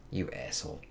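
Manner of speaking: breathy whispered jab at the villain; whispered insult. Real, not acted. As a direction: hiss it under your breath.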